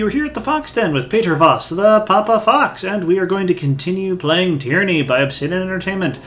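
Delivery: loud, upbeat host welcome; show host intro. Acted. Streamer welcoming viewers back to the channel, upbeat host voice.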